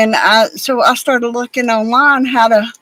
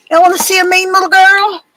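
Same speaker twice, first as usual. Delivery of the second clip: higher and louder, proud sing-song; excited pet talk; 8 semitones higher, 4 dB louder than usual. Real, not acted. Owner proudly showing her dog to viewers, high excited pet talk. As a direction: show off the little dog with glee.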